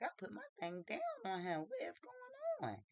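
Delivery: acted-out disbelief. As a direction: retell her baffled protest, stretching the last question upward. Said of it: "she can't believe it".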